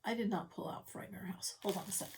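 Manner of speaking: quiet unvoiced whisper to herself; a soft whispered aside. Real, not acted. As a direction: whisper it as you realize.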